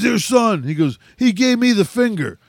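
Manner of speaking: high, building to a shout; raging dad. Retold or imitated. Raging dad confronts the boy's father, building to a shout. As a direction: work up from a high rant to a yelled challenge.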